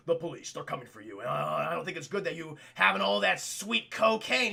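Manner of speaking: low and quick, secretive warning; ominous caller. Acted. Caller warning Chad about the police, low fast voice.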